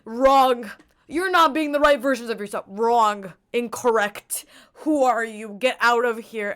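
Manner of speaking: loud scolding rejection of her; mock angry crowd. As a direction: bark it out like a jeering crowd. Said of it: "they're gonna be like".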